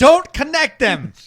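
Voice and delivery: high, loud, drawn-out plea; slow, overdramatic cry of warning; mock alarm. Tech pleading about the blue and green servers, mock-alarmed.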